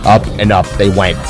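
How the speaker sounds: ordinary delivery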